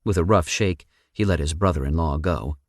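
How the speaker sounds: ordinary delivery, nothing marked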